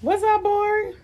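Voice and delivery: high, loud hammed-up hello; squeaky mock shout-out to the viewers; playful greeting. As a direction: greet the chat in a big put-on voice.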